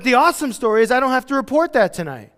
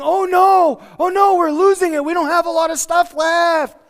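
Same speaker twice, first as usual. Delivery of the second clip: much higher, louder mock panic; 9 semitones higher, 6 dB louder than usual. Believer losing resources, hammed-up panicked whine. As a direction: whine it like a fake crisis.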